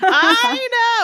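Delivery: high, loud excited I know; excited squeal. Real, not acted. Excited squeal to co-host about the friend's letter. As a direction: squeal it with delight.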